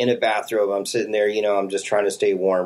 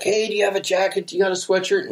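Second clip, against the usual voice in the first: higher-pitched hopeful asking; pitched-up mock plea; acted plea; 9 semitones higher, faster than usual. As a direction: lightly call out to passers-by for help. Asking passers-by for clothes.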